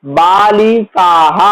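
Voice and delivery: बालिकाः said slowly, stretching the final visarga "ह"; drawn-out बालिकाः with a clear "ह" breath at the end; slow visarga demo. Shown as a demonstration. Teacher showing students the plural with visarga, said slowly. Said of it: "बालिका का बहुवचन होता है".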